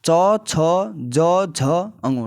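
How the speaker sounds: चौ, छ, ज, झ, अंगो, tongue on the palate, said one by one; reciting palatal letters. Shown as a demonstration. Teacher demonstrating palatal letters. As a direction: sound each letter off the palate. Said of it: "हम देखते हैं चौ वर्ग".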